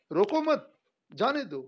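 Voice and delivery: रोको मत very high in one go, जाने दो dropping after the break; pause after मत. Shown as a demonstration. Teacher repeats the opposite version, pause after मत. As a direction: join मत to रोको. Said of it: "ये एकदम उल्टा है".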